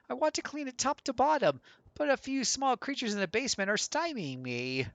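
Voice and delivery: high-pitched, swooping gentleman's voice; genteel homeowner. Acted. Gentleman asking help with creatures in the basement, genteel high voice.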